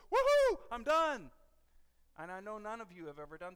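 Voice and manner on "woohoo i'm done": loud, high drawn-out cheer; triumphant whoop. Retold or imitated. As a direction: whoop it out like the chore is finally over. Retelling his relief at finishing reading.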